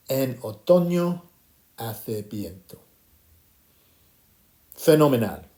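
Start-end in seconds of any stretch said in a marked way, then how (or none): 0.1-2.8 measured teacher demonstration in Spanish; slow language model. Shown as a demonstration. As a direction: model it slowly, letting the second half fall away. Teacher repeats model phrase for class.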